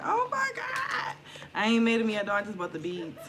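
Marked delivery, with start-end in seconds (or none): 0.0-1.2 very high, drawn-out mock squeal; playful high-pitched fan impression; excited viewers' reaction. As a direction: imitate fans gushing, pitched way up. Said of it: "Y'all gonna be like".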